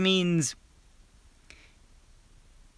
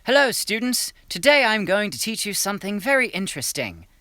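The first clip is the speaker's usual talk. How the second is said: a raised, lecturing professor voice; 6 semitones higher than usual. Acted. Professor opening his lecture to students, voice pitched up. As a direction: greet the class like a pompous professor. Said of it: "He said".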